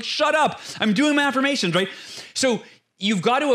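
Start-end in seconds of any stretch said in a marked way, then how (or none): 0.0-1.8 mock insistence. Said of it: "you're like".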